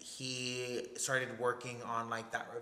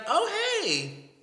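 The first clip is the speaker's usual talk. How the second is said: high-pitched, louder "oh", dropping on "hey"; mock greeting; 6 dB louder than usual. Imitating a distant friend walking up at the bar, mock greeting. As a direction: imitate an over-the-top bar hello.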